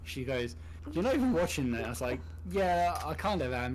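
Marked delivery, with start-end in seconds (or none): 0.9-1.9 mildly raised, higher-pitched retort; challenging. Retold or imitated. Wife catching husband not watching the show, challenging. 2.5-3.7 higher-pitched mock protest; defensive. Husband caught playing solitaire by wife, defensive. Retelling his defensive reply.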